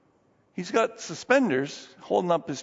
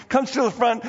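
ordinary talk, nothing marked

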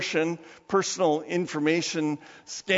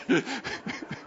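amused laugh; 7 semitones higher, 6 dB quieter than usual. Real, not acted. Laughing at own punchline.

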